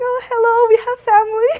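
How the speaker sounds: high, loud mock-delighted hello; retold excited greeting. Siblings greeting newly found relatives, retold with high excitement. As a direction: pitch it way up, thrilled to discover relatives.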